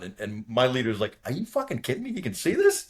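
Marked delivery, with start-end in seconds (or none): 1.2-2.9 high-pitched mock disbelief; incredulous. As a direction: imitate a stunned leader, pitch shooting up. Leader stunned by wingman's sighting.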